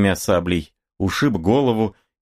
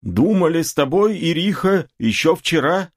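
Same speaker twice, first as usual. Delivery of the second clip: put-on high-pitched old man's voice; high-voiced grandfather talking; 10 semitones higher than usual. A narrator's reading. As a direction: address her in a raised, elderly voice. Old man starts speaking to Ириха.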